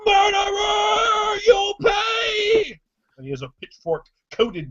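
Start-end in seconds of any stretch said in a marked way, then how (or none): 0.0-2.8 very high, loud, slow sobbing accusation; furious crying shout. Acted. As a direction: scream vengeance at the murderer. Young man charging with pitchfork.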